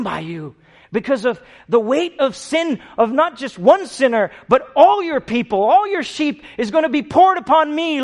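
pleading prayer rising to a loud, high cry; Jesus pleading. Acted. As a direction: let the prayer swell into a desperate cry. Jesus voiced pleading to the Father.

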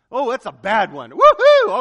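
loud, sky-high cheer at a trial; gleeful whoop. Retold or imitated. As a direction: whoop like a fan at kickoff. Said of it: "that's kind of the way I'm built".

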